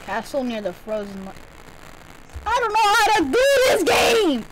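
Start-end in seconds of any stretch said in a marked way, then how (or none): 2.5-4.5 high, loud, exasperated outburst; frustrated shout. Real, not acted. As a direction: vent at the game. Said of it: "frustrated outburst".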